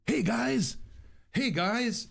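high, hammed-up hey guys; mock YouTuber greeting. Vlogger mocking other YouTubers for his viewers, high hammed-up voice. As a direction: parody a peppy YouTuber intro. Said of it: "what do they, they all say?".

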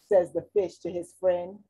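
usual speaking voice

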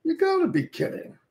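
hushed then a jump way up in pitch; mock disbelief. Streamer retelling his broken headset, mock disbelief. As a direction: act out the headset dying on him. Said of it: "I said".

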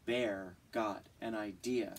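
plain reading voice

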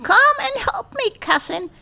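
high, bright cheerful calling out. Acted. Country Mouse calling his cousin to work, cheerful shout. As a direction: call him cheerfully to come help. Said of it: "he shouted cheerfully".

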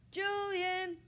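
high, loud call; boy calling for help. A narrator's reading. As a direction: shout for someone in another room. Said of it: "he called".

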